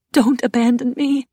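pleading. A narrator's reading. Plea to the absent in story.

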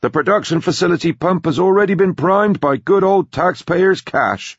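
put-on high, loud, punchy old businessman; brash old tycoon. Grandpa selling his company's project, brash and loud. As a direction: crow it out like a pushy magnate.

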